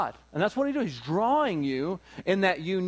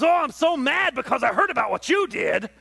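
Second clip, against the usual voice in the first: mock angry God; 8 semitones higher, 5 dB louder than usual. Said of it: "And he goes".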